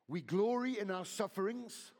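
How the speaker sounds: loud, lifted reading of the verse; fervent scripture reading. Acted. Preacher reads Romans to the church, loud and fervent. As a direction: proclaim the verse.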